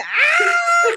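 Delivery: very high mock scream; hammed-up scream of terror; imitated scream. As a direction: shriek like your panicking friend. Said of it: "just to hear you screaming".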